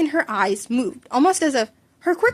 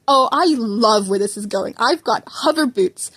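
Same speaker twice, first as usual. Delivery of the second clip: a hint of a gleeful inventor, louder; lightly voiced Mei; 5 dB louder than usual. A narrator's reading. Mei excited to show Izuku her hover boots, gleeful. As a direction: relish the idea, slow on the boots.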